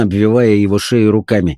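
plain reading voice

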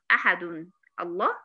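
أحد ends on a still "n", then الله separately; slow "ahadun, Allah", questioning; wrong unjoined demo. Shown as a demonstration. Teacher asking if this can be said, wrong unjoined demo. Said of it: "طب ينفع أقول".

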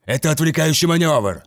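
high, loud battlefield warning; commanding shout. A narrator's reading. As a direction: bark the order to the squad. Captain shouts warning to warriors.

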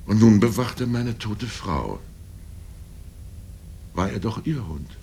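ordinary delivery

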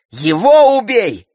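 high, loud, drawn-out cry; shouted echo. A narrator's reading. Sister echoes brother's demand, shouting.

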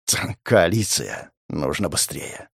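low, soft undertone; muttered annoyance. Acted. Interrogator reflecting as time runs out, muttering. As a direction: mutter it to yourself, urgency held in. Narrator's muttered private reaction.

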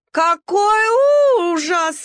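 high, very slow wailed horror; howling dismay. A narrator's reading. Nelli wailing in horror to the other dogs, high and slow. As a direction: wail it like a fussy dachshund. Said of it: "взвыла Нелли".

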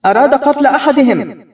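a mother in judge's robes shouting from the stage. Acted. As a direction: proclaim it like a stern judge yelling. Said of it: "وتصرخ بنا".

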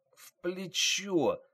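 drawn-out, high-pitched groaned answer; wounded man forcing the words out slowly; pained. Acted. Wounded driver answers in pain.

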